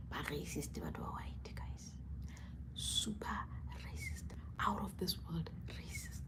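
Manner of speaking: secretive whisper. Real, not acted. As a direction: whisper it like a confession. Confides racism remark secretly.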